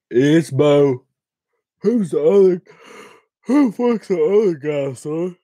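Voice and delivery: shouting a question to chat; loud, excited call to the viewers. Real, not acted. Calling out to viewers.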